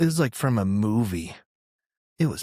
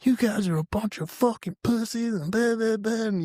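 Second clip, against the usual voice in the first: much higher mock insulting sergeant; drill sergeant tirade; 12 semitones higher than usual. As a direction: berate the platoon in a high mock rage. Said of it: "just going off".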